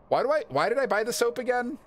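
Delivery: high, quick, incredulous questioning; self-questioning exasperation. Real, not acted. Bewailing own soap purchase.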